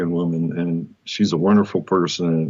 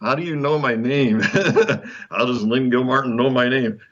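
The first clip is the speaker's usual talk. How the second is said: stunned; 5 semitones higher than usual. Retold or imitated. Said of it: "I almost passed out".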